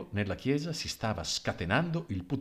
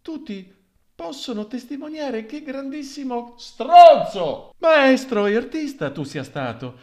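high, loud sarcastic mourner voice, swelling on the insult; a mourner turning praise into an insult; mourner's mocking eulogy; 13 semitones higher, 11 dB louder than usual. Mourner mocking the dead artist with a loud high voice.

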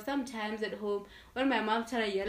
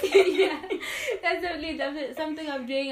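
laughing, higher and louder; laughing agreement, higher pitched; 7 semitones higher, 9 dB louder, faster than usual. Real, not acted. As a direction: giggle through the admission. Laughing agreement after friend laughs.

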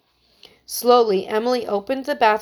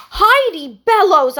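loud, slow scolding of the full name; stern rebuke; 9 semitones higher, 9 dB louder, slower than usual. A narrator's reading. Emily scolds her friend for watching TV: loud stern rebuke. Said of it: "her eyes blazing".

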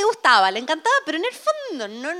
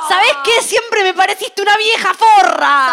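louder, slow, biting insult; mock angry telling-off; 5 semitones higher, 7 dB louder, slower than usual. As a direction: tell the mother off for good. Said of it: "le decís a la mamá". (reproche furioso a la suegra, en broma)

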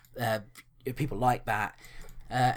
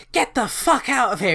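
a higher, louder, snarling get-lost; angry brush-off; 10 semitones higher, 7 dB louder than usual. Retold or imitated. Retelling the woman who curses the searchers, a loud angry snap. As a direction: bark the woman's rude dismissal.

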